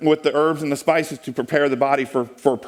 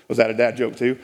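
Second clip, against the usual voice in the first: usual speaking voice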